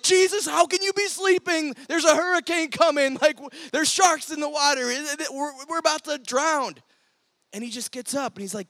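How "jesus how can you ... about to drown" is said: high, loud panicked pleading; freaking out. Retold or imitated. Disciples in the storm yelling at sleeping Jesus, high panicked cry. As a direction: panic like men about to drown. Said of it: "the disciples are freaking out".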